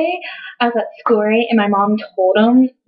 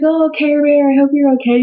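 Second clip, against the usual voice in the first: lightly voiced, higher, doting dad; concerned dad; 6 semitones higher than usual. Dad checking on his crying daughter, mock concern. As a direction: imitate a fussing dad, pitched up.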